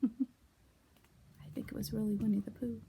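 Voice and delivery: unvoiced laughing whisper; whispered aside. Real, not acted. Teacher whispering to her class.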